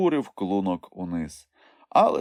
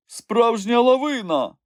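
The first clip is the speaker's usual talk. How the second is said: higher, slow, louder thrilled cry; delighted exclaim; 5 dB louder than usual. A narrator's reading. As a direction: marvel at the avalanche. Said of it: "захоплено вигукнув Нюхмумрик". (захоплений вигук)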